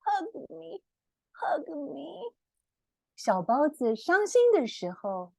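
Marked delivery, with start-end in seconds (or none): 0.1-2.3 soft, teary hug me; tearful plea. Acted. Reading to children, the sad bunny begs for a hug. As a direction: plead softly through tears. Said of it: "he tears up and says".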